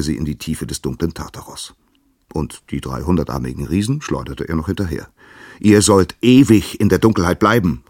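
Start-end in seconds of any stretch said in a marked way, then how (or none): 5.6-7.8 loud, high call from above; Uranus shouts his sentence down into the dark. Acted. Uranus calling down to his sons, loud and high. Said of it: "rief er ihnen von oben zu".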